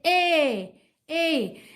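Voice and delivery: "A" called out long, as if listening; long A sound. Shown as a demonstration. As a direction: call the vowel like a question to someone far. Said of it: "cup your hand over your ear ... and say".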